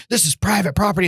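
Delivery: retold indignant warning; angry landowner. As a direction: imitate an irate man warning off a trespasser. Irate landowner confronting trespasser.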